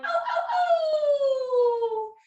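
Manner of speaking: high coyote howls; coyote yips. Shown as a demonstration. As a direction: keep howling like a coyote. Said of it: "Keep it going".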